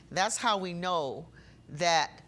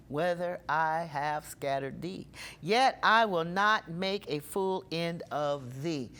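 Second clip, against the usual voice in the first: solemn scripture reading; 4 dB louder than usual. Acted. Reads the next verses aloud.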